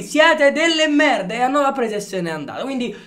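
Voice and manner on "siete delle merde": higher, slow mock outburst; De Laurentiis quote. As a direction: shout it like De Laurentiis leaving. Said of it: "per citare Aurelio De Laurentiis".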